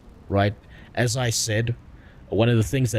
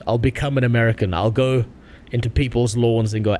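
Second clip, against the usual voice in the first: normal talking voice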